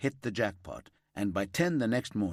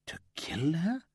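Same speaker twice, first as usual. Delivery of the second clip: higher breathless whisper of disbelief; stunned whisper; 8 semitones higher than usual. A narrator's reading. Grieving friend whispering disbelief to the detective. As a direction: barely voice it, reeling.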